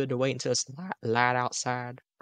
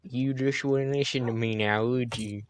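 slow, exaggerated southern drawl; fake southern accent; slower than usual. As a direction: lean hard into a Southern twang. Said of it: "say everything ... in the accent".

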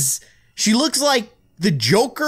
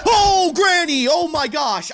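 startled outcry; 8 semitones higher than usual. Real, not acted. Player finding Granny outside the door, startled. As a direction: yelp as if caught off guard.

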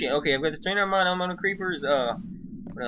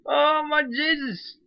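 shocked exclamation; 13 semitones higher, slower than usual. Real, not acted. Surprise at explosion.

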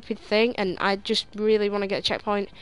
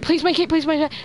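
higher, louder, urgent begging; real desperate pleading; 7 semitones higher, 6 dB louder, faster than usual. Real, not acted. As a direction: urge the car over the gap. Willing the car to land.

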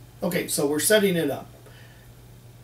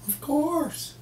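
high, sing-song wife voice, much higher; breezy mock wife, pitched way up; imitating a wife; 10 semitones higher than usual. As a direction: play the wife answering as if it's obvious. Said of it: "if you ask a woman".

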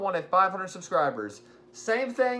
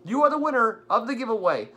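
hyped giveaway announcement; 5 semitones higher, 6 dB louder than usual. Real, not acted. Congratulating the giveaway winner.

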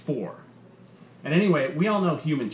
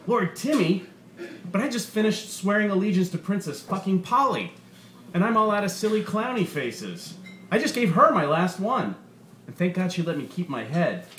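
higher, hammed-up incredulous protest; mock outrage; 8 semitones higher, 3 dB louder than usual. A narrator's reading. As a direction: act wildly put-upon for laughs. Comic's mock outraged reply.

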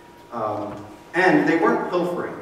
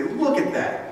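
much higher, pointing out the offence; mock accusing Pharisee; 9 semitones higher, slower than usual. Pharisees pointing at the disciples to Jesus, mock higher accusation. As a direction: call attention like a tattletale. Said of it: "that's exactly what they say".

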